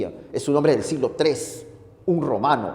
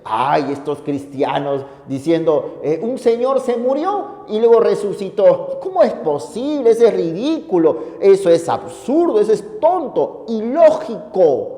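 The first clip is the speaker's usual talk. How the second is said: higher, sneering mockery; scoffing know-it-all voice, pitched up; mocking scoffer; 5 semitones higher than usual. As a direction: ridicule the resurrection like a smug skeptic. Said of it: "Y dicen".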